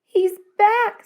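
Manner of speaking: high, eager cry of relief; girl thrilled to hear the cat again, excited. A narrator's reading. Bursting with hope.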